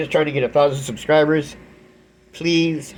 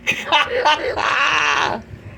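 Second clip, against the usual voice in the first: high shrieking laugh; 14 semitones higher than usual. Real, not acted. Karma moment, laughing hard.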